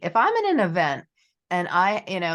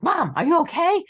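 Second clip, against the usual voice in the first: put-on high, louder worried child's question; nervous kid; 10 semitones higher, 5 dB louder than usual. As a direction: imitate an anxious kid checking on mom. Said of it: "my kids will get nervous".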